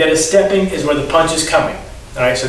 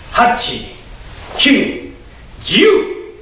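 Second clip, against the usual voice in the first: jiu called much higher, kyu and hachi plain; count inflection; 8 semitones higher, much slower than usual. Shown as a demonstration. Sensei showing students the cue, rising count. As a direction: build the count so jiu jumps up high. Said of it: "you'll hear an inflection in my voice".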